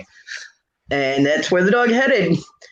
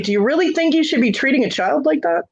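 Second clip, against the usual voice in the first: higher, mock-indignant question; sarcastic retort; 9 semitones higher than usual. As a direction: turn her own words back on her. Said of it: "I was like".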